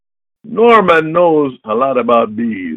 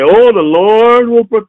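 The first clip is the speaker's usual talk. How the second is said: mocking; 8 semitones higher, 7 dB louder than usual. Mocking meek Christians.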